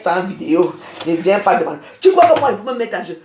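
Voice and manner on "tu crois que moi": higher, louder scornful challenge; an indignant cry; indignant outburst. Real, not acted. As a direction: fire the question back in outrage.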